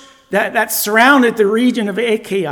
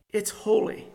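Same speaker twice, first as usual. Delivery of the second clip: near-whisper, much softer; hushed emphasis; 9 dB quieter than usual. Real, not acted. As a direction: drop to a whisper to land the point. Preacher hushes for a reverent point.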